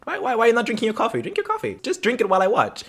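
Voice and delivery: high, hurried mock-mom nagging; pushy mother. Mother pressing coffee on her son, pushy.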